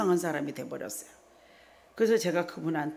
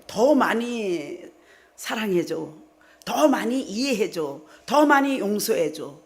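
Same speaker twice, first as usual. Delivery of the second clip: slow, louder insistent demand; 3 semitones higher, 5 dB louder, slower than usual. Retold or imitated. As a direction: stretch each word out as a pushy demand. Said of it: "자꾸 요구해요". (남편에게 자꾸 요구받는 듯 느리고 크게 조르는 말투)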